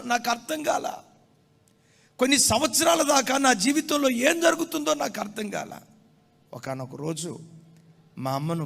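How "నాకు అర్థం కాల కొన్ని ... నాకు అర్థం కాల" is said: loud, high pulpit proclamation; fervent sermon voice; full-force preaching. Acted. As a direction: preach it out to the congregation.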